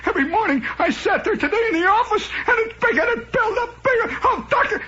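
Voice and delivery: very high, building mock hysteria; panicked patient. Patient pouring out his pain to doctor, hammed-up panic. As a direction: wail as the dread builds. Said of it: "he's worried, he's sweating".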